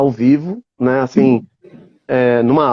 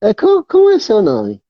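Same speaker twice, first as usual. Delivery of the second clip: lightly voiced old puppet; a hint of a curious grandpa; Robinson McAllister; 7 semitones higher than usual. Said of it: "aqui está Robinson McAllister".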